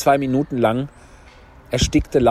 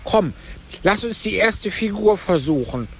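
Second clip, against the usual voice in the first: high, plaintive turtle voice; Mock Turtle; 9 semitones higher than usual. A narrator's reading. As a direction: pitch it up for the tearful turtle. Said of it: "sagte die falsche Schildkröte". (hohe Stimme der falschen Schildkröte)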